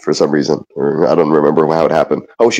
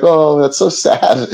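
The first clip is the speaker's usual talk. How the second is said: higher, slow mock pity; pitying friend; 9 semitones higher, much slower than usual. Friend's pitying remark retold.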